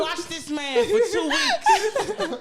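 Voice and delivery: high, loud, laughing and shouting; excited shout. Real, not acted. Telling friends about her man, high laughing shout. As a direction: blurt it out, overjoyed.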